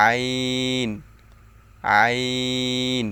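long, held আইন from mid-throat; slow demonstration of আইন, made mid-throat; mid-throat letter. Shown as a demonstration. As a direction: draw আইন out steadily from the middle of the throat. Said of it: "হলকের মধ্যখান হইতে দুইটি অক্ষর".

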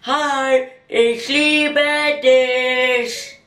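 high, drawn-out creepy love call; stretched, high-pitched weirdo declaration; creepy bus-stop guy. Acted. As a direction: call out like an unwelcome admirer. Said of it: "dem gruseligen Typ an der Bushaltestelle, die ihr immer zuruft".

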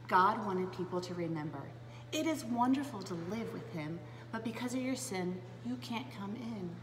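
ordinary delivery

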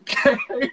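a quick high burst of laughter; short laugh. Real, not acted. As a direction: let a brief giggle slip out. Laughing while telling her story. (risa breve y aguda)

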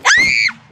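sudden high shriek of fright; real startled scream; frightened scream. Real, not acted. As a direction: scream at the jump scare.

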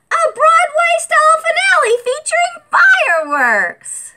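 high, loud Broadway flourish; showy excitement. Acted. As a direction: belt it like a showstopper. Benny describes grand finale.

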